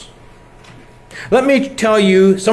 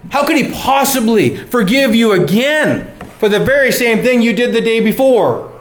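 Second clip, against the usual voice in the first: accusing inner voice; 7 semitones higher than usual. Acted. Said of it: "that little voice again that says".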